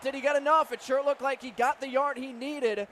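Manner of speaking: ordinary delivery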